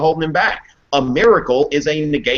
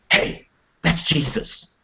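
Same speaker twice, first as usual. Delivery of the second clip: slow hushed whisper; clueless classmate; 2 semitones higher, slower than usual. Retold or imitated. Classmate pointing out Jesus in a whisper. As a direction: whisper it slowly like stating the obvious. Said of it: "always this person who's like".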